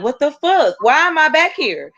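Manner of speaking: high-pitched, alarmed disbelief without real hyperventilation; retold panic. Retelling being put in the cop car, mildly panicked. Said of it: "hyperventilating now 'cause I'm like".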